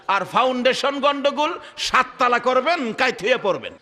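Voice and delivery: chanted storytelling with long-held high notes; melodic preaching. Real, not acted. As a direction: stretch out the names and pleas, sinking at the end.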